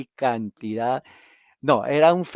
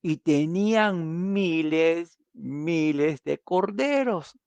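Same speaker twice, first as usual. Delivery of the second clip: drawn out; 4 semitones higher, much slower than usual. Real, not acted. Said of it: "stretched for emphasis".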